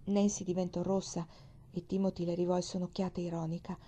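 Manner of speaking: ordinary delivery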